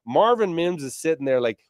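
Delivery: ordinary delivery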